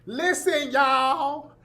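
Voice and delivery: high, drawn-out Southern preacher singsong. Retold or imitated. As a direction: stretch every phrase in a mocking Southern preacher chant.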